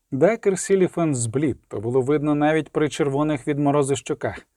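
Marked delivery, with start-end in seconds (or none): none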